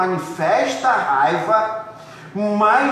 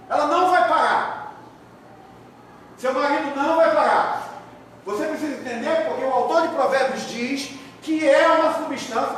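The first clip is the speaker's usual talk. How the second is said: fiery preaching; 8 semitones higher than usual. Acted. Pastor warning the congregation about anger, fiery preaching. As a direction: preach it hard and build to a shout. Preacher builds to full force.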